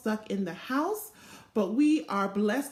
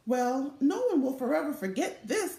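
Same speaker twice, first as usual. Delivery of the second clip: ordinary delivery, nothing marked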